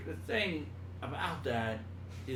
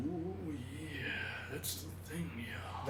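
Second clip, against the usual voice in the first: soft Macho Man; 9 dB quieter, slower than usual. Shown as a demonstration. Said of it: "You gotta bring it down".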